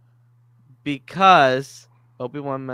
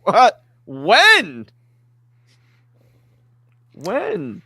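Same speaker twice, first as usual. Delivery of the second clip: surprised; 9 semitones higher, 9 dB louder, much slower than usual. Retold or imitated. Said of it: "And I was like".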